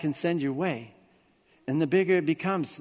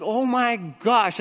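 high, drawn-out mock panic; retold panic; 6 semitones higher, much slower than usual. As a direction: stretch it out in acted alarm. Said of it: "I'm, I'm panicking".

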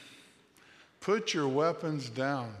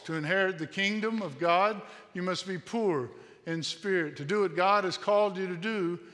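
preaching paradoxes; 3 semitones higher than usual. Acted. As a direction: ring out each paradox to the congregation. Preacher building paradox litany.

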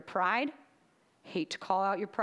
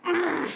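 loud, high balking "mm"; reluctant groan; 7 semitones higher, 6 dB louder than usual. Retold or imitated. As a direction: grunt of protest at an order. Retold balking at God's order.

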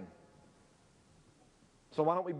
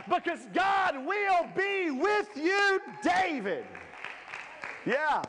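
higher, louder preacher, shouting the big lines; preaching at full force; 9 semitones higher, 3 dB louder than usual. Acted. Pastor urging the church to go, preaching at full force. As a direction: fire up the congregation.

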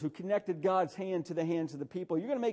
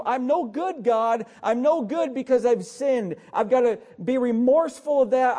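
loud, driving sermon delivery with hard-hit words; full-force preaching; 4 semitones higher, 6 dB louder than usual. Acted. Pastor urging his congregation, preaching at full force.

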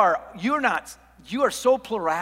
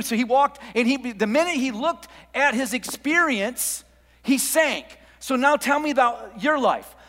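higher and louder, driving the point home; rising pulpit fervor; fervent preaching; 5 semitones higher, 4 dB louder than usual. Acted. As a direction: preach it to the congregation at full force. Preacher builds to full force.

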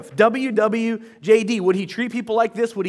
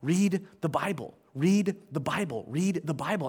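same soft falling tune each time; steady repeated refrain, low and soft; beating the drum; 5 semitones lower, 7 dB quieter than usual. Shown as a demonstration. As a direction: repeat it in low, even, measured beats. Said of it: "constantly beating that drum".

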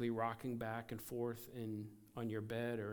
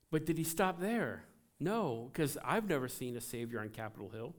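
ordinary talk, nothing marked

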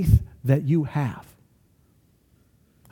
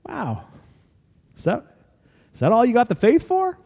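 underwhelmed disbelief; 4 semitones higher than usual. Acted. Jesus unimpressed by small prayers.

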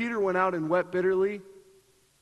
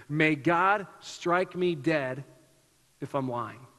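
grave oath; 4 semitones lower, slower than usual. Retold or imitated. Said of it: "which essentially means this".

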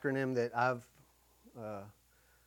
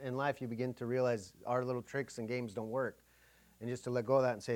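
normal talking voice

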